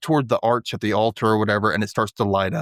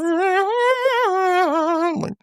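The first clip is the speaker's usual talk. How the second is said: mock sad tune. Cartoony hummed music.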